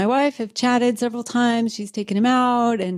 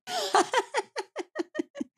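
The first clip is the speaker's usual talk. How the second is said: high burst of laughter; 7 dB quieter than usual. Real, not acted. Laughing at the facilitator joke, high laugh.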